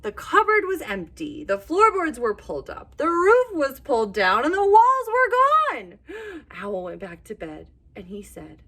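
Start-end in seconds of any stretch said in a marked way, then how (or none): none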